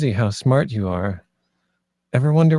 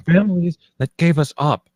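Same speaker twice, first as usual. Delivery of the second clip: bitter retort; 5 semitones higher than usual. Acted. Friend cuts in bitterly against the plan. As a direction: throw the word families back at him. Heated argument over families.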